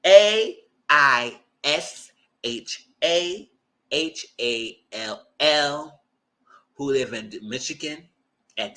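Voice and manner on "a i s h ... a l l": slow spelled out. Retold or imitated. Spelling name for cops.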